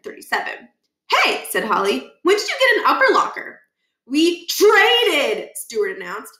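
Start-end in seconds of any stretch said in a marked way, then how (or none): 1.1-3.6 much higher, fast and laughing; high girl voice, quick and amused; Holly's voice. Acted. Holly asking Stuart about his new locker, amused. 4.1-5.5 put-on much higher, louder proud kid voice; Stuart's voice. Stuart announcing the locker trade, proud and high.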